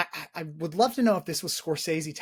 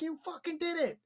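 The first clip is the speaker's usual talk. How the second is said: mock elation; 13 semitones higher than usual. Retelling Henry's shower celebration, mock elated. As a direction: act out excitement in a much higher voice.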